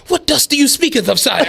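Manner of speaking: lightly voiced mock-courtly question, laughing; a put-on archaic knight asking about dust; mock old-timey speech. Coworker mocked as a courtly knight, asking about dust, mock-courtly.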